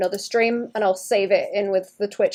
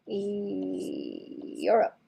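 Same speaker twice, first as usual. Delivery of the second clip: whisper; 3 semitones lower, 12 dB quieter, much slower than usual. Pretend. Whispered hint of continent.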